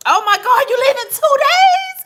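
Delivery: high, loud gushing squeal; excited squeal. Real, not acted. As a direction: squeal with joy for her friend. Friend's surgery news on livestream.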